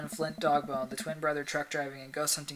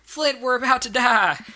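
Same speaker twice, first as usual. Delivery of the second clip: loud, high, drawn-out Southern cry of doom; hammed-up Southern trucker yelling to his brother; Southern accent; 9 semitones higher, 11 dB louder, slower than usual. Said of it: "All right, southern accent, southern accent".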